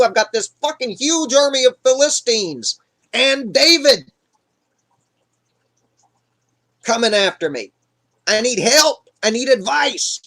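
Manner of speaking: desperate plea. Retold or imitated. Saul begging Samuel's help against the Philistines, desperate. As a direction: beg for help, pitch climbing.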